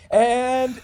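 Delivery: high, held-out "and"; photographer's cue. Retold or imitated. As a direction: imitate a photographer counting in the shot. Photographer's cue before the shutter.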